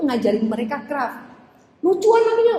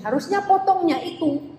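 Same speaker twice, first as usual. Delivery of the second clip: a child's higher Javanese lilt, lightly imitated; funny Javanese child; 6 semitones higher than usual. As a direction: mimic a little village kid correcting the teacher in Javanese. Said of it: "cara ngomongnya saya nggak bisa niru". (tiruan ringan logat Jawa anak kecil yang lucu)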